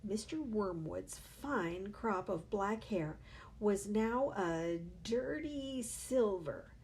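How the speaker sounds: ordinary delivery, nothing marked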